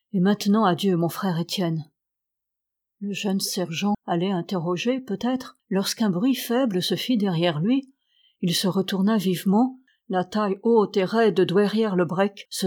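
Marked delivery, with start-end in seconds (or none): none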